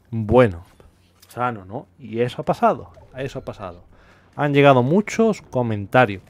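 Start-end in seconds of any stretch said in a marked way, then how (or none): 3.1-3.8 softer and lower, repeated to himself; quiet echo. Shown as a demonstration. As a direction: let it drop to a quiet aside. Repeats line, second time hushed.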